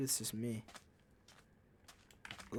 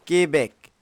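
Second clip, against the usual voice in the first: put-on loud, higher caricature of a Quebec accent; mock Quebec accent; 5 semitones higher, 13 dB louder than usual. Gamer poking fun at how locals sound, mock Quebec accent. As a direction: burst out with a silly Québécois accent. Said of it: "they kinda sound like that".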